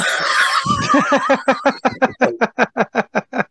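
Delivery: big shouted laugh, high and loud; loud burst of laughter. Real, not acted. To the hosts, loud laugh at the memory. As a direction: let out a loud whooping laugh.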